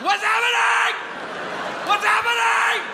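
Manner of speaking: very high, shrieked mock wail; hysterical crying. Substitute breaking down before the class, hysterical crying. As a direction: wail it in panic at the top of your voice. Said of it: "Why are you crying so much".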